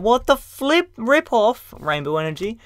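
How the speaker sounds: put-on high, squeaky kid whining. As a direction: whine like a let-down kid. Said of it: "I'd just be like".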